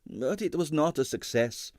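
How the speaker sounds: put-on high, heavily accented excitable impresario; Stroganoff's Russian accent. A narrator's reading. Ballet impresario to the detective, in a Russian accent.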